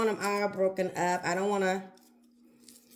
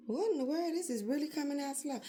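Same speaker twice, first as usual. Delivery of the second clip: surprised; 4 semitones higher, 8 dB quieter, faster than usual. Real, not acted. Dressing bottle barely pouring.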